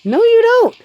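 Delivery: high, loud emphatic no, you don't. Real, not acted. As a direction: push it up and out hard. Contradicting viewers, said twice.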